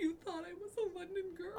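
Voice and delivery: high, loud, delighted disbelief; thrilled squeal. Retold or imitated. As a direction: squeal it like a flattered fan. Thrilled reaction to being taken as Londoner.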